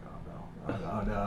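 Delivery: breathy panicked whisper of oh no; terrified whisper. Retold or imitated. Author at a crowded signing whispering in terror. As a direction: whisper it like you're scared stiff. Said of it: "I'm terrified".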